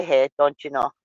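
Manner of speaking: mock Wisconsin cheesehead talk; Wisconsin accent. Indianian joking about cheeseheads up by Green Bay, put-on Wisconsin accent. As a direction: lay on a playful Wisconsin accent. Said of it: "your little Wisconsin accent".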